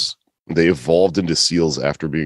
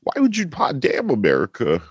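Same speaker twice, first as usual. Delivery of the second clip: drifting impression; 11 semitones higher than usual. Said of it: "who am I becoming?".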